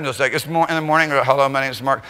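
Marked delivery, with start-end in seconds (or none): 1.2-2.0 low, unexcited hello; low and unimpressed, just the name. Shown as a demonstration. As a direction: say it like it's no big deal, lower. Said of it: "I wasn't real excited".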